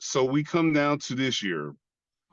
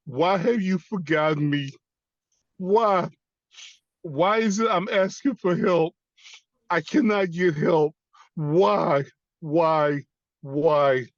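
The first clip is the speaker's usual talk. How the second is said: raised, insistent cries of why; desperate pleading; 5 semitones higher than usual. Retold or imitated. Veteran begging God for help, desperate outcry. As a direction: beg for an answer, high and forceful. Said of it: "I kept asking God".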